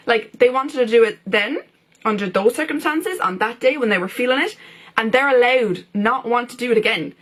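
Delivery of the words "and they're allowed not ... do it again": normal talking voice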